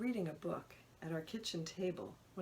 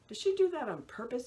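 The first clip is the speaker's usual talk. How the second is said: loud, higher, pushy question; half-joking demand; 7 semitones higher, 9 dB louder than usual. Acted. Anxious patient pressing the nurse on the phone, mock demanding. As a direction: demand it, only partly kidding. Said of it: "I demand".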